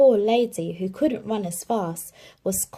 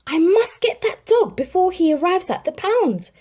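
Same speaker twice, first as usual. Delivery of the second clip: high, louder urgent worry; anxiously; 11 semitones higher, 7 dB louder than usual. A narrator's reading. Jim worries about Tramp at the pound, anxious. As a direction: say it in a rush of worry. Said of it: "said Jim anxiously".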